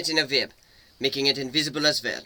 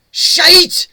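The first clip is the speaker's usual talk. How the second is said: high, loud startled curse; panicked yell; 14 dB louder than usual. A narrator's reading. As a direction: yelp it in panic. Monster's barb snaking toward him.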